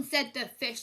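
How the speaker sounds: usual speaking voice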